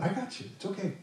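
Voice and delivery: put-on like calming a small child; soothing a little kid. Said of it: "you tell a little kid that".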